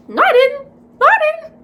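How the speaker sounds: very high, loud protest; retold shocked, high-pitched outburst; incredulous denial. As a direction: squeal the denial in disbelief. Denies that she died.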